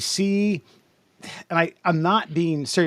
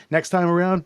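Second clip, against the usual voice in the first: usual speaking voice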